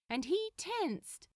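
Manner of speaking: ordinary delivery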